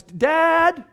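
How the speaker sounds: put-on child interrupting a meeting; child calling dad. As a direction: call out like an eager child.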